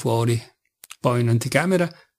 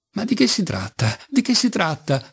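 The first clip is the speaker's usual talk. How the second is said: outburst; 7 semitones higher than usual. A narrator's reading. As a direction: burst out high and urgent. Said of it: "proruppe Lise". (Lise prorompe, voce acuta e concitata)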